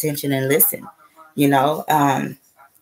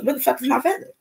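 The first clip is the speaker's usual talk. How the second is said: high-pitched mock exasperation; high, pleading mock complaint; acted frustration; 11 semitones higher, faster than usual. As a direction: squeal the question in playful frustration. Acts out frustrated search for feather.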